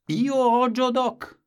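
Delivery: loud, high, slow exclaiming of "Io ho Joe Doc!"; exclamation. A narrator's reading. Grandfather proclaiming his new word, loud and high. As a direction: belt it out as a joyful cry.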